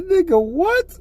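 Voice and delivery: shocked. Retold or imitated. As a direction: screech it in shocked disbelief. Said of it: "I said".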